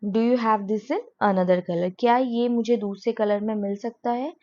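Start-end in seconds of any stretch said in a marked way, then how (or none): 0.1-1.9 slow, clear teacher's model question; slow classroom demo; slow model sentence. Shown as a demonstration. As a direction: say it slowly for learners. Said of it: "ठीक है".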